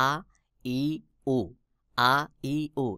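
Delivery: আ, ই, উ each separate, from the top of the throat; demonstrated pronunciation. Shown as a demonstration. Teacher demonstrates hamza from the throat, আ, ই, উ separately. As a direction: sound out আ, ই, উ one by one. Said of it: "গলার শুরু থেকে আমজাহ উচ্চারণ হয়".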